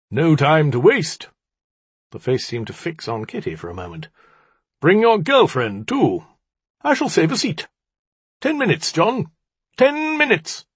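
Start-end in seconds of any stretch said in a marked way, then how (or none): 0.1-1.3 loud, high, drawn-out urging; raised, put-on voice from the orb; disrupted orb voice. A narrator's reading. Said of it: "A voice issued forth, similarly disrupted". 4.8-10.6 very high, theatrical call; disrupted orb voice. A narrator's reading. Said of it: "A voice issued forth, similarly disrupted".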